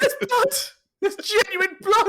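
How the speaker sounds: high-pitched, loud retold shock; reliving alarm at the sight of blood; shocked disbelief. Friend recounting an accident he caused, shocked disbelief.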